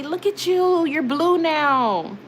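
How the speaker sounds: high, drawn-out delighted coo; gushing excitement. Real, not acted. Streamer admires mod's new blue name, gushing excitement. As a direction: gush proudly, stretching the words.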